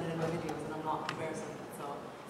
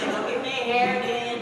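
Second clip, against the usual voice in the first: louder, higher, showing how her family talks; a lightly done loud relative; 6 semitones higher, 7 dB louder than usual. Shown as a demonstration. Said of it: "they talk like this".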